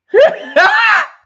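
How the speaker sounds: big crying laugh, loud and high; loud laugh burst. Real, not acted. Laughing hard while telling friends. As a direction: howl with laughter at the memory.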